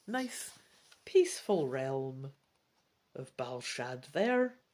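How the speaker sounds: usual speaking voice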